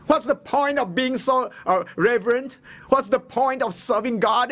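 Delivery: high whiny complaint; mock bitter wife. Preacher mimics a bitter widow scoffing, high whiny voice. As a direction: pitch it up and whine like a resentful wife. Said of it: "She didn't say".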